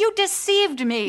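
upset, reproachful; wounded accusation. Acted. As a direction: accuse him of betrayal. Fiancée accuses him in quarrel.

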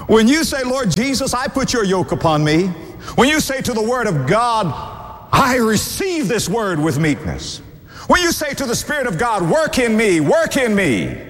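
high, rhythmic sermon build; impassioned high-pitched pulpit appeal; fervent preaching. Real, not acted. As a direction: preach each clause high, like a rising litany. Preaching at full force.

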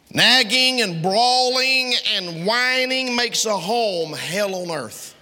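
high, slow, drawn-out proclamation; preacher's high, slow dramatic declaration; emphatic. Acted. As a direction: stretch each word up high to hammer it home.

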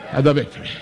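usual speaking voice

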